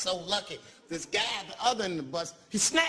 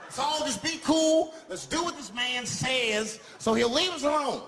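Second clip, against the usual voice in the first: high, slow, pleading passenger; nervous passenger; 7 semitones higher, 3 dB louder than usual. Retold or imitated. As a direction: coax everyone to cooperate.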